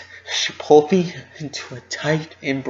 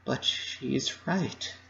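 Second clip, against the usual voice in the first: much softer, slow and spent; hushed, worn-out admission; 10 dB quieter, slower than usual. Acted. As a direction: barely get the words out after crying. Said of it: "I said".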